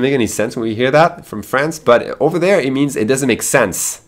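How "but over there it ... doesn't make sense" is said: usual speaking voice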